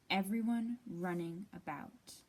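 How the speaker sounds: ordinary delivery, nothing marked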